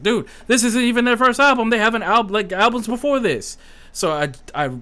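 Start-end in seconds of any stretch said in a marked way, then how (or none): 0.0-3.6 excited friend. Retold or imitated. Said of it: "And he's like".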